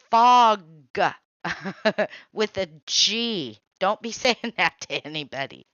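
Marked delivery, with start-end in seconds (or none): 0.1-4.5 much louder "fog", long o and a clear g; emphatic correction. Shown as a demonstration. Correcting the coworker again, a much louder "fog". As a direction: belt out the right word.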